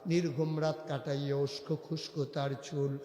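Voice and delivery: ordinary talk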